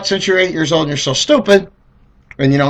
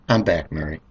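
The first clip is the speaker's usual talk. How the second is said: low, soft, sugary phone voice; sweet mom voice; 10 semitones lower, 8 dB quieter than usual. Retold or imitated. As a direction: switch to calm sweetness. Mom calmly back on phone.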